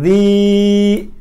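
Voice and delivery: "Zi" held long on a level note; long vowel. Shown as a demonstration. Teacher shows students the long letter, "Zi" drawn out long. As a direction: stretch out the vowel of "Zi". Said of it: "Zi ini panjang".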